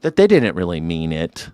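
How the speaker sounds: lightly hammed-up sincere speaker; mock earnest heritage claim. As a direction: parody someone claiming authority from ancestry. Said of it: "I'm sure emotionally it works".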